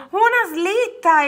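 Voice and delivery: high, stretched-out cry of joy; slow, thrilled outburst; delighted exclamation. A narrator's reading. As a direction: marvel at the gift. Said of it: "esclamò Orso".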